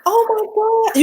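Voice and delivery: high-pitched tearful moan; real overwhelmed crying. Real, not acted. Streamer moved by the unfinished portrait, tearful. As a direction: let happy tears push the voice way up. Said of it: "I'm gonna cry".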